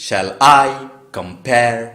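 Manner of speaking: loud beats on "I" and the second syllable of "compare"; iambic stress. Shown as a demonstration. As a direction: hit I and com-PARE, pausing between. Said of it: "এইভাবে বলবো". (I আর pare-এ জোর)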